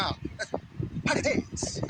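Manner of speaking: breathy, drawn-out mock English accent; a hammed-up English accent, breathy and very slow. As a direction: stretch हाँ very slowly like a posh English speaker. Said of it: "ऐसे अंग्रेज ऐसे बोलता है ना".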